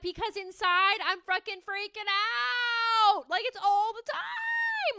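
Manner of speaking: high, drawn-out wail; hammed-up freak-out. Explaining her inner state to everyone, hammed-up freak-out. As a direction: stretch the last words into a panicked cry.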